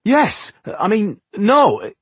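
high, slow, halting self-correction; flustered. A narrator's reading. Tungdil answers the mason about the deal, flustered. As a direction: contradict yourself in a fluster.

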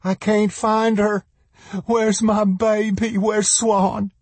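put-on high, panicked cry for her child; frantic mother. A narrator's reading. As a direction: wail it out in a high voice. Mother frantically seeks buried child.

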